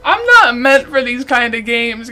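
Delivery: usual speaking voice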